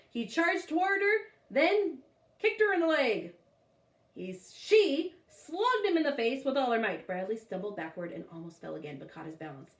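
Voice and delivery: ordinary delivery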